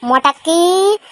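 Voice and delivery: name flung across the room; stern call. Acted. Mother calling again.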